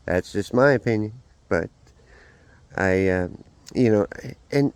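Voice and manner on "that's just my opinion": ordinary talk, nothing marked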